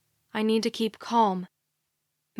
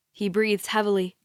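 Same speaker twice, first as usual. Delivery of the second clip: usual speaking voice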